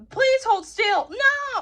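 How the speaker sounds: ordinary talk, nothing marked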